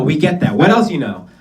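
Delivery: ordinary talk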